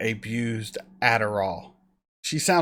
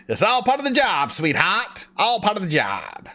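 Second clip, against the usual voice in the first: hammed-up nineteen-thirties movie character, pitched up at first; bad old-movie impression; 6 semitones higher than usual. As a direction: do a badly faked old-movie line. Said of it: "That was a bad impression".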